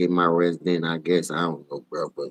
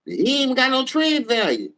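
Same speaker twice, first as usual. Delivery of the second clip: mocking disbelief. Real, not acted. Mocking the trade idea.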